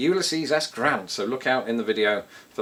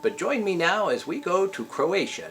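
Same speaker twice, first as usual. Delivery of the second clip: TV travel host voice; bright travel-show presenter pitch; Rick Steves; 6 semitones higher than usual. Pretend. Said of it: "the British Rick Steves".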